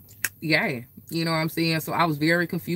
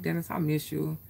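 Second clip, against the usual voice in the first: soft missing him; 9 dB quieter than usual. Retold or imitated. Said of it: "I was like".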